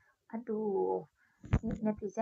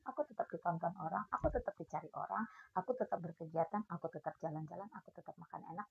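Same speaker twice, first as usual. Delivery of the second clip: usual speaking voice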